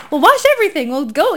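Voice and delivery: high, loud overzealous burst; mock over-eager volunteer. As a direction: jump in too keen. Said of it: "you know, like".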